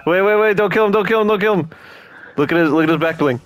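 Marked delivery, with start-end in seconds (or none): 0.1-1.7 urgent, high-pitched pleading; urgent high shout. Real, not acted. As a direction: cry out to stop them. Urgent call to teammates.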